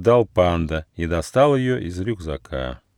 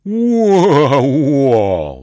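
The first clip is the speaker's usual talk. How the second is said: long, loud falling roar; giant's roar; 6 semitones higher, 6 dB louder than usual. A narrator's reading. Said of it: "взревел Кауфу".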